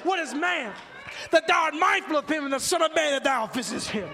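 ordinary talk, nothing marked

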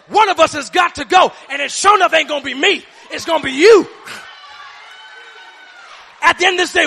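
loud, driving preacher's shout; preaching at full force. Acted. Preacher rallying the church against the devil, shouting. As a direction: hammer it home to the congregation.

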